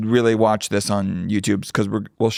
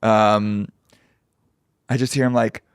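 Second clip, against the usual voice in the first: ordinary talk, nothing marked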